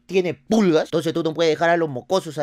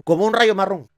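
ordinary talk, nothing marked

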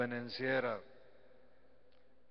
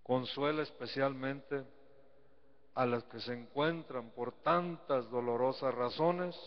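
prayer; 3 dB quieter than usual. Acted. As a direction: pray for the people, unhurried. Leads a prayer.

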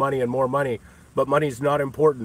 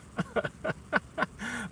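breathy wheeze laugh; 10 semitones higher, 11 dB quieter than usual. Real, not acted.

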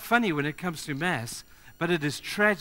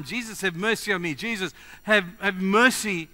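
higher and louder desperate calling; loud crying out; 5 semitones higher, 6 dB louder than usual. Retold or imitated. Blind beggar crying out to Jesus over the crowd, loud and desperate. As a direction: call out desperately across a crowd. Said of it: "with a very loud voice".

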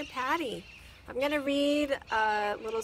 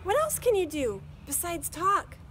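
put-on girl voice; 8 semitones higher, 7 dB louder than usual. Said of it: "Chrissy questions the robot".

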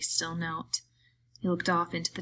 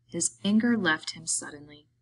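plain reading voice

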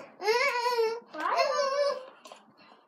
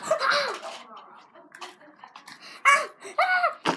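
playful pretend shriek of fright; mock scream; 6 semitones higher, much slower than usual. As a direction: shriek as if seeing a spider. Pretend spider scare in camera.

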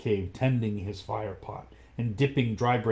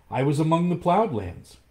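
put-on higher and louder, bright young voice; eager boy; 7 semitones higher, 5 dB louder than usual. Mowgli answers the panther, eager and raised.

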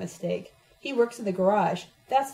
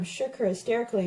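plain reading voice

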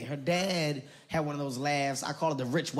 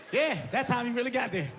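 ordinary talk, nothing marked

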